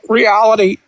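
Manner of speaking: high, dismissive one-word answer; a high mocking imitation; mocking opponent voice. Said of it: "And they go".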